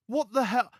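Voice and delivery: high, loud, startled exclamation; surprised. Retold or imitated. Hearing his cousin's song playing in the shop, high and startled. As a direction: react like the music just caught you off guard.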